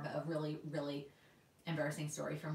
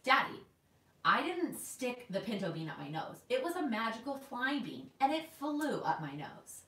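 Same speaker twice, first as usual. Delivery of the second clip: retold little kid's earnest denial, pitched higher; totally serious child; 6 semitones higher than usual. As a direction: insist to Daddy with a straight face, stretching the ending. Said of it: "totally serious".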